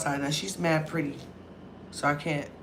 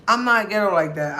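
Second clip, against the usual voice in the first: ordinary delivery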